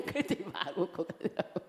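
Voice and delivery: words breaking through real laughter; laughing. Real, not acted. Telling how the elder laughed and left, laughing. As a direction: talk while still laughing. Laughing over own anecdote.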